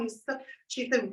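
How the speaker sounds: ordinary talk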